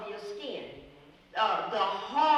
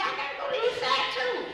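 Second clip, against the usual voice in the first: much higher, fast, eager echo; excited reply; 4 dB louder, faster than usual. Retold or imitated. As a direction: blurt it out in surprise. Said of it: "Jen said".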